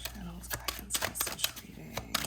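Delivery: soft unvoiced whisper. Real, not acted. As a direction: breathe it out as a whisper. Murmured while shuffling the cards.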